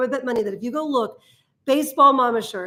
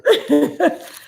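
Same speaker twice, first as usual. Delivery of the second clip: short laugh; 3 semitones higher, 4 dB louder than usual. Real, not acted. Still laughing at the jab.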